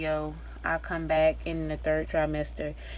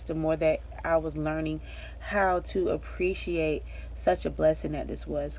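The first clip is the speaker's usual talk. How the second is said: ordinary talk, nothing marked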